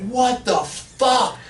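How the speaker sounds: high, very slow, drawn-out What the fuck; stunned disbelief. Retold or imitated. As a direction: stretch out the shock. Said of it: "I was like".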